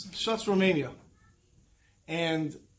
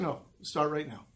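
firm quiet urging; 4 semitones lower, 7 dB quieter than usual. Real, not acted. Rebutting the third excuse.

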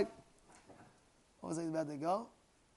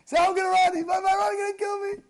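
very high, loud mock wail; despairing fox; 12 dB louder than usual. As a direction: cry out in hammed-up panic. Acts out fox's despairing panic.

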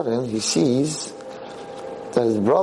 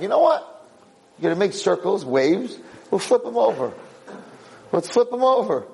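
scheming brothers; 7 semitones higher than usual. Acted. Brothers scheming to tip kayak.

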